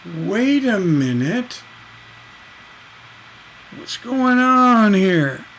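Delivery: slow, stretched-out disbelief rising to a loud high cry; hammed-up double take, every word drawn out; mock astonishment. Mock shock at first person.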